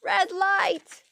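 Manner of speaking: high screamed warning; shrieking backseat driver. A narrator's reading. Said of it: "Tempest, screamed".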